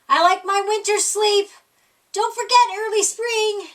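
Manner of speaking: a hint of a sleepy bear insisting; lightly voiced bear. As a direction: nudge him firmly about the promise. Bear reminding Groundhog.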